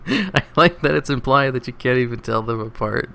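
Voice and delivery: talking through real laughter; laughing. Real, not acted. Reader cracking up at her own joke, laughing. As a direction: let the giggles spill through the words.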